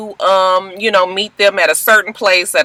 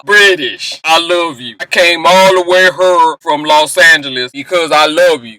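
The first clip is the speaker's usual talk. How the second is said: lower, earnest mock-romantic voice; Lorenzo's love speech; 6 semitones lower than usual. Boyfriend declaring love to British in Paris, mock-earnest and low. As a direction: ham up a sappy proposal. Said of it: "he gotta start with his speech".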